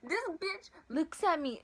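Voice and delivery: ordinary delivery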